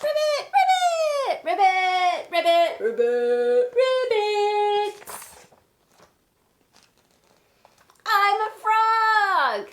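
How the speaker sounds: high, loud, gleeful ribbit croaks; Piggy croaking like a frog. Acted. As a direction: belt out the ribbits as a proud new frog. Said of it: "when I say the piggy parts".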